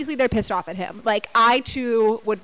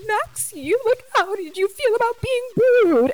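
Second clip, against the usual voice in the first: higher pitched mock interviewer; mock reporter; 10 semitones higher, 3 dB louder than usual. Mimicking a reporter quizzing Max about the boos. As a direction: play the fussy reporter asking sweetly. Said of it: "they're like".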